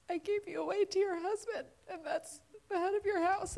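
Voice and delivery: crying. Real, not acted. Daughter retelling her dying dad's words, crying. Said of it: "I'm gonna cry".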